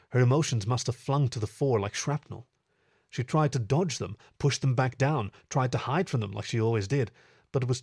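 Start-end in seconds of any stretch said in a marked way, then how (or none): none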